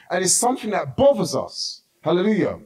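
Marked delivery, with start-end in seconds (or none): none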